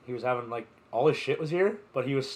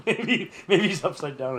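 laughing; 6 semitones higher, 6 dB louder than usual. Real, not acted. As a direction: talk through laughter. Laughing while joking.

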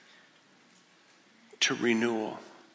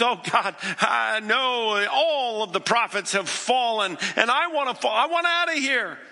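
much higher, louder anguished cry; despairing Elijah; 8 semitones higher, 3 dB louder than usual. Acted. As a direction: cry out like a man at the end.

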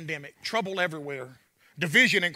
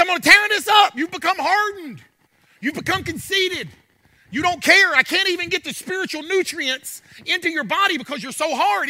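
shouted, much higher, stern voice of God; God's voice; 12 semitones higher, 11 dB louder than usual. Acted. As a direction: thunder it out as God tearing things up. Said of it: "He said".

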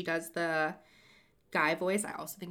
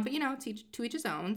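ordinary delivery

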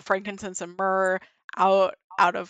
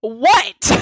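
loud, higher, laughing outrage; outraged disbelief; 6 semitones higher, 8 dB louder than usual. Retold or imitated. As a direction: blast him for how quick that was. Mocking his speed.

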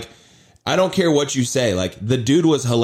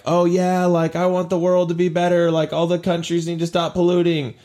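higher-pitched mock little-girl voice; 5 semitones higher than usual. Little girl pleading for a better world, mock-earnest imitation. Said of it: "that little girl that was like".

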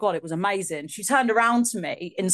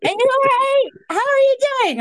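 gushing [name]; 6 dB louder, faster than usual. Retold or imitated. [name] greeting Holly in DMs, high gushing voice. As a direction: squeal the hello like an excited star. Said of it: "she was like".

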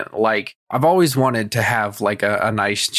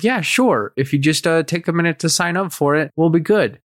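higher-pitched, agreeable nurse imitation; a hint of an obliging receptionist, pitched up; lightly voiced nurse; 6 semitones higher than usual. Nurse agreeing to a payment plan, lightly imitated higher voice.

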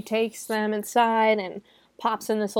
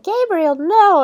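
high, loud melodramatic plea; retold scream of protest, pitch way up; mock desperate cry; 11 semitones higher, 10 dB louder, slower than usual. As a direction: cry out like a horror heroine, hammed up. Heroine cries out at killer.

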